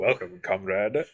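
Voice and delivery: slow, low, lightly faked Russian accent; mock Russian accent. Plays along as Soviet comrade.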